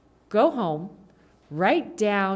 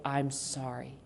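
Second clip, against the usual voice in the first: soft apology; 5 semitones lower, 10 dB quieter, slower than usual. Shown as a demonstration. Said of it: "Be able to say".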